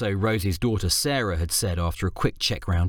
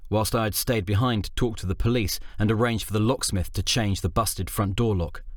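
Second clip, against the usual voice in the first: plain reading voice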